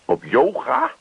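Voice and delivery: high, disbelieving question; verbijsterd. Acted. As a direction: yelp it in disbelief. Henk shocked at yoga. (hoge ongelovige uitroep)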